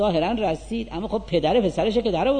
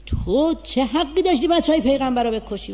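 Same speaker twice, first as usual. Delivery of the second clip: a higher, louder shout at Yazid; retold shout; 8 semitones higher, 5 dB louder than usual. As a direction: call him out as if in the court. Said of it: "داد زد سر یزید".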